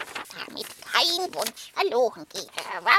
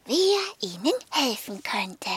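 usual speaking voice

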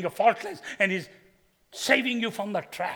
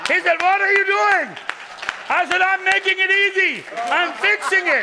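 much higher and louder, animated punchline; excited retelling; 13 semitones higher, 9 dB louder than usual. Retold or imitated. Preacher acts out the punchline to the congregation, loud and high. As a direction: crank it up high and loud as you deliver the joke. Retold exchange with church guy.

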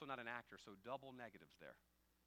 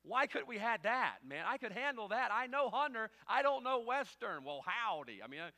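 loud, high exasperated pleading; protest, half laughing at himself; comic protest; 9 semitones higher, 13 dB louder than usual. Real, not acted. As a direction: pastor begging not to play the sheriff. Pastor's comic protest about sheriff role.

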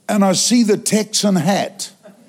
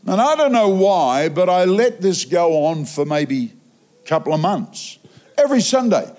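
ordinary delivery

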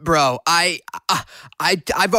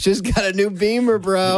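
mock bro; 5 semitones higher than usual. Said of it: "That's the worst Facebook status".

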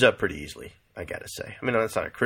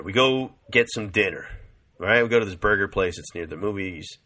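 normal talking voice

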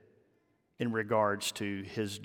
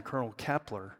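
ordinary talk, nothing marked

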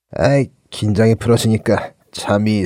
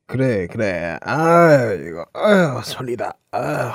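sleepy mumbling; slower than usual. Acted. Sisera drifting off to sleep.

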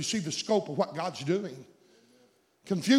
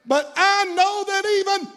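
Martha's faith; 12 semitones higher, 7 dB louder, slower than usual. Retold or imitated. Martha's words preached high.

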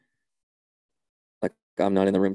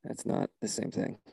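muttered aside; 9 dB quieter than usual. Acted. Muttered reply to absurd claim.

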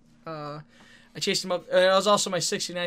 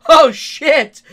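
startled shout; 9 semitones higher, 17 dB louder than usual. Retold or imitated. Opponent startled again.

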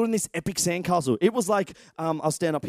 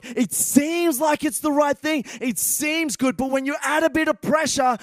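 loud, higher preaching, building to a call; full-force preaching; 7 semitones higher, 4 dB louder than usual. Acted. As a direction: drive it home from the pulpit. Preaching climax.

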